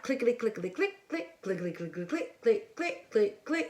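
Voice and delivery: soft, rhythmic mouse-click imitation; imitated clicking. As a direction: patter out the clicks lightly, like sound effects.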